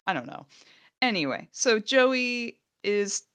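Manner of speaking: usual speaking voice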